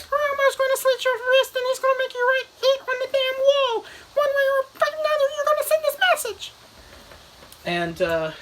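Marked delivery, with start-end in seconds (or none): 0.0-6.5 very high, loud falsetto puppet threat; Elmo. Retold or imitated. Puppet bursts from TV threatening man, shrill falsetto. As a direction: rattle off threats in a squeaky puppet voice. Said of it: "And Elmo's, ... the puppet".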